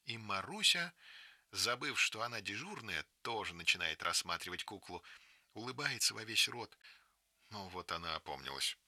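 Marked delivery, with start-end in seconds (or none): none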